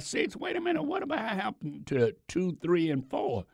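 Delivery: high, quiet puzzled aside; mock surprise. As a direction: act baffled that numbers were skipped. Mock surprise at skipped numbers.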